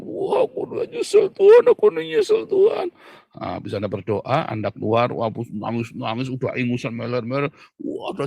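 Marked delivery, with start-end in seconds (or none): 0.0-2.9 very high whiny wail, hammed up; mock tantrum prayer. Preacher mimics a sinner's tantrum prayer, high whine. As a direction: mimic a sulky sinner wailing to God. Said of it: "Lalu Anda mulai mukul-mukul diri sendiri, tantrum".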